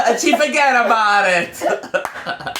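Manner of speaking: slow, high hammed-up Italian accent, laughing; Italian Bill Cosby. Cohosts riffing on an old bit, hammed-up Italian accent. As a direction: ham up an Italian accent slowly. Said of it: "the Italian Bill Cosby".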